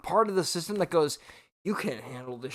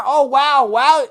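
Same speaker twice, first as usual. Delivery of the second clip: much higher and louder sarcastic wow; loud exclamation; 10 semitones higher, 14 dB louder, slower than usual. Real, not acted. Streamer to chat, reacting loudly to his own hiccup. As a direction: burst out in mock amazement.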